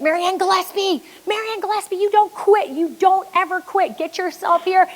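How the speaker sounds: high, insistent announcer urging her on; Mike Riley. Retold or imitated. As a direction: imitate an urgent call to an athlete. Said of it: "Mike Riley walks out".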